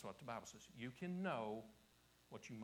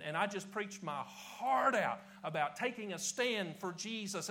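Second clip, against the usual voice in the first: preaching heart out; 8 semitones higher, 13 dB louder than usual. Acted. Preacher recalling his rousing army-of-God sermon, full-force preaching. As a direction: preach your heart out, louder with every phrase.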